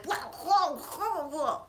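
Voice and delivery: high monster-dog noises, mimicked; mock creature clamor; imitated alien dogs. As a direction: blah out a snarling creature impression. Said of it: "the dogs are like".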